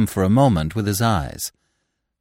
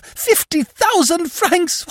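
very high, loud shriek; 3 dB louder, slower than usual. A narrator's reading. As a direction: shriek orders at the police. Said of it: "shriek at the top of her voice".

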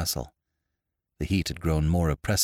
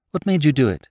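higher, abrupt challenge; abrupt question; 9 semitones higher, 4 dB louder, faster than usual. A narrator's reading. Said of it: "he asked abruptly".

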